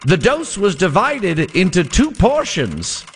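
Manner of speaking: put-on old-timey radio voice. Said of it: "old-timey radio voice".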